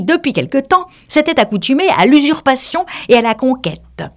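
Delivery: high, prim lecturing mouse; mouse reciting history. Acted. The mouse reads dry history to dry the animals, high and prim. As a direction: recite the driest lesson with pride.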